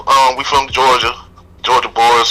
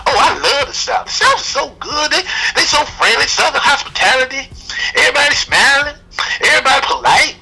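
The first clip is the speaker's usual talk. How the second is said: mock sugary high voice, much higher pitch; gushing tourist; 13 semitones higher than usual. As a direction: play the overly sweet visitor, mocking them. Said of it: "people come into town and they be like".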